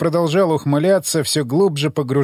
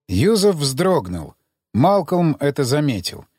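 ordinary delivery

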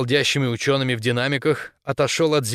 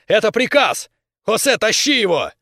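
loud, high urgent yell; shouted order; 8 semitones higher, 5 dB louder than usual. A narrator's reading. Captain ordering Aaron out of the tunnel, shouting. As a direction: shout him back with full authority. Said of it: "опомнился и прокричал".

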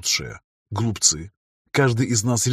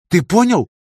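high, loud insistent question; stern demand; 9 semitones higher, 7 dB louder than usual. Acted. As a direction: demand he promise. She insists.

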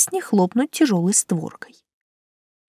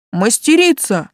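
raised, higher calling voice; calling after; 4 semitones higher, 5 dB louder than usual. A narrator's reading. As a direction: call out to someone walking off. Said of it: "крикнул вдогонку Талан". (окликая вдогонку, громко)

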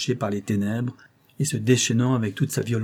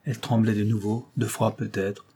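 ordinary delivery

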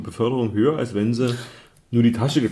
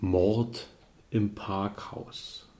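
dramatic title announcement; 2 semitones lower, 6 dB quieter, much slower than usual. Acted. Dramatischer Titel der Geschichte.

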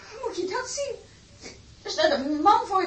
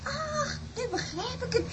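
startled realisation; 7 semitones higher, 10 dB quieter than usual. Acted. Iris suddenly understands.

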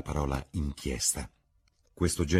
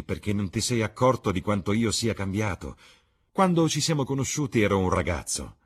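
emotional, self-justifying speech, swelling louder in bursts; lightly voiced impassioned young man; 5 semitones higher, 6 dB louder than usual. Dorian defends his changed self to Basil, lightly voiced, impassioned. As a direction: plead and protest by turns, lightly in character.

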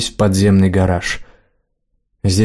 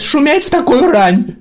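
put-on shrill, much higher crazy old crone; shrieking old woman; slower than usual. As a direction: screech like a batty old lady. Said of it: "точь-в-точь выжившая из ума старая фурия".

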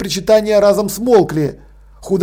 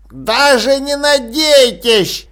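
higher, slow gurgling gloat; gloating old man; 5 semitones higher, much slower than usual. A narrator's reading. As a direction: taunt them with glee. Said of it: "клокотал он".

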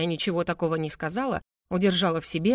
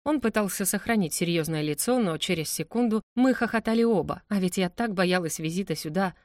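plain reading voice

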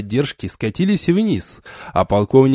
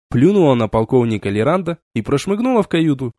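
ordinary delivery, nothing marked

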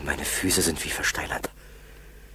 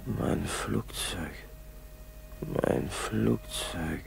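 longing; 6 dB quieter, much slower than usual. Acted. Waking from fever, longing.

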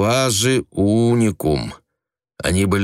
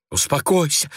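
higher, hushed alarmed plea; frightened girl hushing her aunt; 8 semitones higher than usual. A narrator's reading. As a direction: beg her to calm down. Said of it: "воскликнула ... испуганная Ася".

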